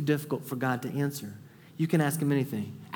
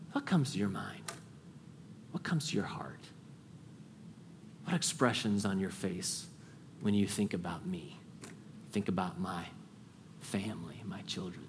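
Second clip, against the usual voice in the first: soft, lower, fading into a whisper; hushed whisper; 8 semitones lower, 10 dB quieter than usual. Acted. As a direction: pray it as if God is right beside you. Intimate whispered prayer to God.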